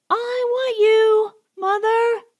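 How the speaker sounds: put-on high little boy's voice, drawn out and wailing; child screaming. A narrator's reading. Bobbity screams for his mother in the night, high wailing child's voice. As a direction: cry for your mother like a small boy. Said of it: "Bobbity screaming that".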